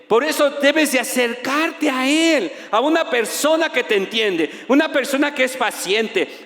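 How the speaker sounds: preacher urging the congregation to come to God; preaching at full force. Real, not acted. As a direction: build the appeal, rising and calling out. Preaching climax.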